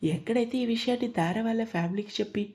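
usual speaking voice

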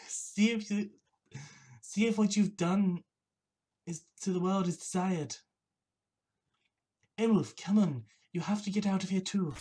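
badly faked Welsh accent, pressing them to leave; poor Welsh accent. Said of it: "a really poor accent of Welsh".

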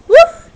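playful woof. Pretend. Imitating a dog for puppy.

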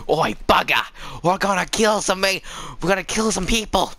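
playful put-on Australian accent. Gamer rallying to kill some people, mock Australian accent. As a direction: talk like a hammed-up Aussie. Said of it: "I wanna just speak Australian".

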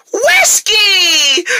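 high, drawn-out stage announcement; showbiz introduction. Acted. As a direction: announce the star act with fanfare. Stage introduction of comedian cat.

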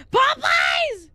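high, loud mock-dramatic yell; mock shout. Streamer yelling the name chat keeps saying, mock shout. Exclaiming over the character's name.

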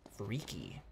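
spooked whisper. Real, not acted. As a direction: breathe the word out, unnerved. Spooked by a blue room.

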